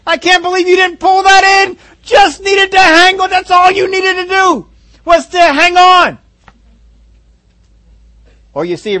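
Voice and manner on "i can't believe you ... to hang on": loud, high, slow mock fan shouting at a dropped pass; frustrated fan yell. Preacher playing a fan who yells at a receiver, hammed-up angry shout. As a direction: bellow it like a disappointed fan in the stands. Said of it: "How many are saying".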